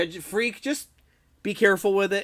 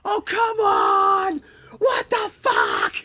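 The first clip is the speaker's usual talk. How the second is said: high, loud cry of protest at losing the race; imitating a gamer's shout of disbelief; frustrated outburst; 6 dB louder, much slower than usual. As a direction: yell it like the race was stolen at the finish line. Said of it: "You're like".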